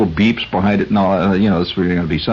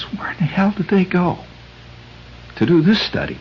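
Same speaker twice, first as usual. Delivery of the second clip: higher, whispered disbelief; incredulous whisper; 9 semitones higher, 3 dB quieter than usual. Retold or imitated. Comic asking where the study was done, in a disbelieving whisper. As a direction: hiss the question in disbelief. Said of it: "I says".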